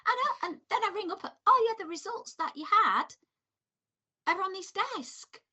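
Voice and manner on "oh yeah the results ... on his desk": imitating receptionist.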